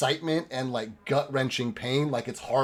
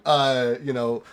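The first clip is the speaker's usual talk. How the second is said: ordinary talk, nothing marked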